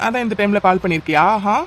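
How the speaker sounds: ordinary talk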